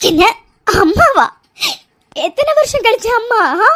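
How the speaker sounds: normal talking voice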